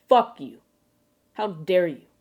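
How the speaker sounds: play-angry retort, punched at the start; mock outrage. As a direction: snap back in hammed-up offense. Mock outrage at Australian slang.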